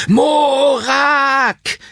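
high, drawn-out cry of the name; dramatic call. A narrator's reading. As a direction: call it out long and high into the night. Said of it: "rief er in die Nacht". (langgezogener, hoher Ruf in die Nacht)

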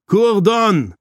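loud, high-pitched call of a name; lauter Ruf. A narrator's reading. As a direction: call out sharply to someone at a distance. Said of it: "rief er". (lauter, hoher Ruf)

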